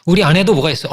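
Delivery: ordinary talk, nothing marked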